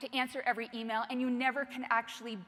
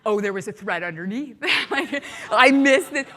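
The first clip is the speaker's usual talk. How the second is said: laughing dismay; 11 dB louder than usual. Retold or imitated. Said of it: "we're like".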